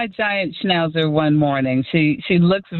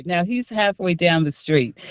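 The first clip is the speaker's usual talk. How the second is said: ordinary talk, nothing marked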